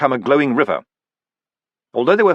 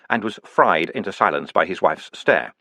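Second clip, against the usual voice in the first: ordinary delivery, nothing marked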